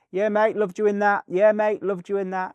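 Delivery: high, loud calling out; imitated fan shouts. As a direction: holler like fans at a star. Imitates fans calling to star.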